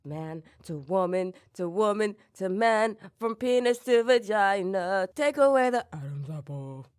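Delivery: artistic voice drop. Pretend. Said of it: "that artistic voice drop".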